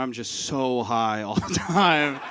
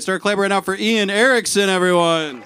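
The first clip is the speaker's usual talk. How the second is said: loud, raised stage announcement; MC introduction; 6 semitones higher, 8 dB louder than usual. Real, not acted. Host bringing up the next comic, rousing announcer call. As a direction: build applause for the next act.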